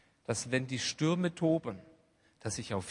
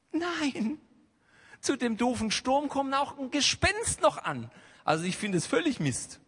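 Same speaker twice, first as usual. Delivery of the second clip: ordinary talk, nothing marked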